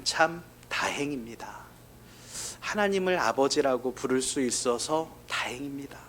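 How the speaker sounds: breathy whisper of relief; whispered hymn words. Retold or imitated. Pastor whispering the hymn's last line, grateful. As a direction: whisper 하나님을 아버지라고 as a private thanks.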